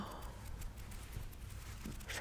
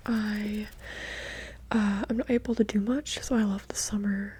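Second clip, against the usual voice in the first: close, unvoiced whispered chat; ASMR whisper; 4 dB quieter than usual. Real, not acted. ASMR creator chatting to viewers in a whisper. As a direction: whisper intimately into the mic.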